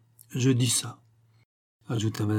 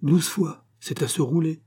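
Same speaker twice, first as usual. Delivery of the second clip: higher-pitched parroting echo; copying monsieur; 6 semitones higher than usual. A narrator's reading. Servant copying his master's words, parroting. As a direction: lightly parrot the master's exclamation. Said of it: "habitué à copier monsieur, de répéter".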